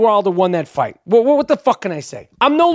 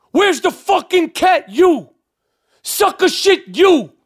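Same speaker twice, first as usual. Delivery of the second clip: mock fan heckle; 10 semitones higher, slower than usual. Mimicking fans yelling at him.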